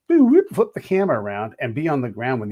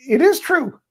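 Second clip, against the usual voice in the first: much higher, louder exasperated retort; frustrated speaker insists to Americans, high and loud; retold exasperated insistence; 8 semitones higher, 3 dB louder than usual. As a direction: hurl it back at the doubting Americans. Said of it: "And I'm like".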